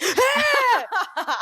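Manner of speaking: put-on high startled cry of a scared boy; startled child cry. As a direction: cry out like a child jolted awake. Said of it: "he's like".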